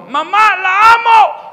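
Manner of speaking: high, loud shout from the roof; a kid shouting love to mom from the terrace; joyful yell. Retold or imitated. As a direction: belt it out to mom far below. Yelling from the rooftop.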